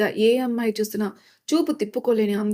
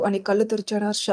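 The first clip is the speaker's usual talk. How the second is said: ordinary delivery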